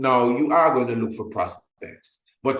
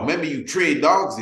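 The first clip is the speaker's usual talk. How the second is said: normal talking voice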